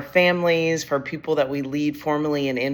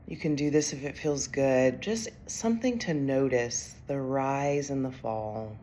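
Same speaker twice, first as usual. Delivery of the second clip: guided meditation; 7 dB quieter than usual. Acted. Coach has leaders notice rise and fall of breath, soft guided meditation. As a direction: ease them into noticing the breath, softly.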